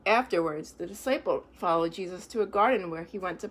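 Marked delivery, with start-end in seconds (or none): none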